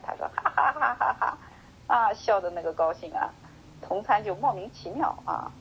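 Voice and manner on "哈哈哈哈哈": a high, hearty ha-ha-ha laugh; hearty mock laugh. Enlightened monk bursting into laughter at his puzzled fellow monk, hearty laugh. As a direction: laugh out like sudden joy. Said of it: "百丈一听又哈哈大笑起来".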